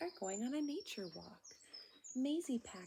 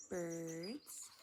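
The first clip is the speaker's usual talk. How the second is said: plain reading voice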